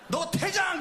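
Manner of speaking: high yelled order; shouted ejection. Retold or imitated. Pastor translating the umpire for the congregation, shouting. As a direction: bark the ejection. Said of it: "그런 거예요".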